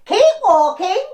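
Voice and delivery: high, loud squawking crane cry; shrill bird-like warning call. A narrator's reading. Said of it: "flap its wings and bob up and down and go".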